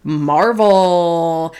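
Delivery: slow, hammed-up radio host intro; radio host impression. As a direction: put on a mock announcer delivery, drawn out. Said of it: "I'll try to sound more like you".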